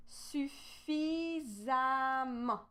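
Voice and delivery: SUFFISAMMENT very slowly, syllable by syllable; slow demonstration. Shown as a demonstration. Teacher sounds out an example for learners, very slow suffisamment. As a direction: stretch out each part of suffisamment.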